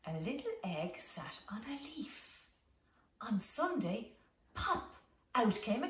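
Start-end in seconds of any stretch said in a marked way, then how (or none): 0.1-2.5 whisper. Acted. Hushed opening of bedtime story.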